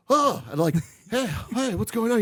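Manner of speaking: high-pitched startled reply; high, disoriented greeting to a friend; acted dazed startle. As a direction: play being roused from a daze. Acts himself coming out of daze.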